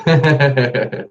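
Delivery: high, loud giggle; short real burst of laughter; laughing. Real, not acted. Amused while thanking a viewer.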